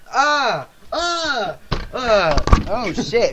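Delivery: high, loud panicked yelps, slowing at the end; overacted panic. Recalling his overacting in a game video, loud high panicked yelps. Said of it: "I'm completely overacting".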